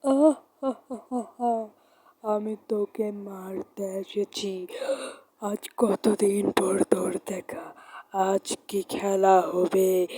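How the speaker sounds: slow, breathy ghost voice with drawn-out words and evil laughs; menacing ghost. Acted. Possessed friend taunts the one she came to kill, menacing ghost. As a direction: draw out each word like a haunting spirit.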